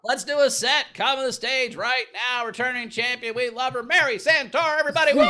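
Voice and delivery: high, loud stage intro, building; announcer introduction. Real, not acted. Host calls returning champion to stage, loud and high. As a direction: bring the act on with a rising whoop.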